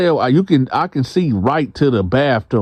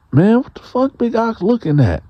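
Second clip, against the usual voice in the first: irritated challenge; 9 semitones higher than usual. Retold or imitated. He voices his own irritated thought.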